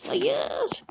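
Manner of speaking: high wailing no, losing the race; a real pained cry of no; anguished no. Real, not acted. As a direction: cry out as the lead slips away. Loses the lead in race.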